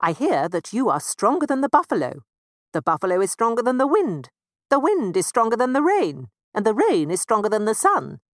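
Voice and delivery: a lightly voiced, eager mouse father. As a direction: run down the whole chain eagerly. Mister Mouse lists everyone.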